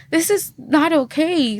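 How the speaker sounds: high, slow, insistent "This is not okay"; high emphatic protest. Retold or imitated. Wife objecting to her husband's calm, high and slow. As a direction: stretch it out in shocked objection. She refuses his calm.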